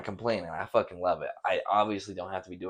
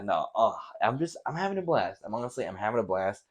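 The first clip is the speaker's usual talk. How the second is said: ordinary delivery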